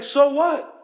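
preacher voicing Jesus's rebuke; Jesus challenging. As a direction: confront Peter with pointed questions. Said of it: "So it's basically saying".

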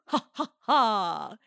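slow, deliberate ha ha ha, hammed-up villain laugh; a staged scornful laugh; mock laugh. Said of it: "they make me laugh".

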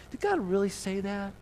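a hint of a sneering tempter planting doubt; sly tempter. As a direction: needle her with mock disbelief. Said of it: "He questions God's motives".

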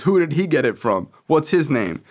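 dad grilling his son for a name; stern interrogation. Retold or imitated.

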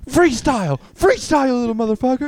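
high, loud playful heckle; mock shouted order. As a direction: yell the demand, hammed-up.

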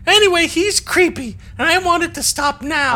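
high, loud put-on complaint; Dana voice. Captain as lieutenant demanding it stop, in Dana voice. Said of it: "That's my Dana voice".

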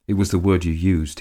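usual speaking voice

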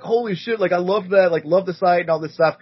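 mock excited fans; gushing fans. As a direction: hype him up like fans. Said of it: "they're like".

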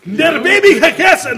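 high, loud fake German barking; mock German shouting. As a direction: yell gibberish German like a cartoon Nazi. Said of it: "I learned".

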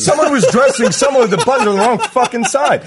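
high, laughing indignation; giggling outrage over the wrong fucking side; laughing rant. Real, not acted.

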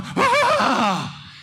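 sudden high shocked "Ah!" from the crowd; crowd gasp. Retold or imitated. Gym crowd watching the throw, shocked gasp. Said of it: "The whole gym is going".

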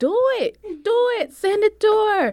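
very high-pitched mock insistence; imitated eager daring. Imitating caller daring her to send it, eager. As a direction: imitate him egging you on, voice way up high.

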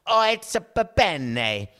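loud, hammed-up mock Italian accent; bad Italian accent. House of Gucci actor imitated, hammy Italian accent. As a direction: burst out in a high, fake Italian accent. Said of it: "it's the Italian accent that is being spoken".

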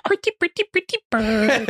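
very high squeaky parrot call. Retold or imitated. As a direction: chirp it out like a parrot. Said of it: "he would also go".

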